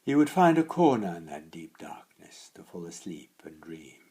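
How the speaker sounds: plain reading voice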